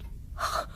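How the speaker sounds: a sharp, offended intake of breath; shocked gasp. Acted. Mrs Dennison gasps as Vernet hints at her interest in Lady Maud's past. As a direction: catch your breath in affronted shock.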